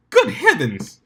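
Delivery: high, loud scandalised cry; Rarity's loud, high shocked exclamation; shocked gasp. Acted. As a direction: clutch your pearls and cry out.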